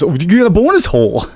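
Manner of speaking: game show host. Pretend. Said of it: "sounds like a fucking game show".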